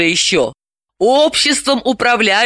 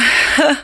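short bitter laugh; one sour laugh at herself; 8 semitones higher than usual. A narrator's reading. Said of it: "горько рассмеялась Далила". (горький смешок)

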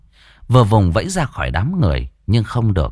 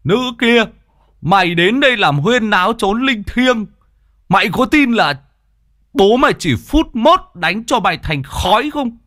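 high, forceful exorcist threat; imperious high-pitched scolding; shouted rebuke; 11 semitones higher than usual. A narrator's reading. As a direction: bellow at the spirit with raised pitch. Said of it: "chỉ vào người kia mà quát".

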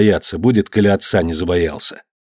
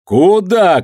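high, louder challenge; full-voiced shout; 9 semitones higher, 4 dB louder than usual. A narrator's reading. Master shouting at a fleeing crowd, high and commanding. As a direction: holler it after the runaways. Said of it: "крикнул Данила во весь голос".

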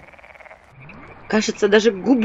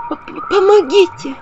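high, stammering squeak; squeak for help; 11 semitones higher, 3 dB louder, slower than usual. A narrator's reading. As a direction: squeak like a dying mosquito. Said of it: "пропищала я недобитым комаром". (испуганный писк)

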